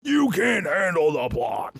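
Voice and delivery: slow, shouted courtroom line; movie quote shout. Retold or imitated. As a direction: bellow it like the film.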